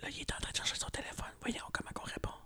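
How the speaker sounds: hushed prank-call whisper. Pretend. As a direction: whisper so the caller won't hear. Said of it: "Chut, chut, chut".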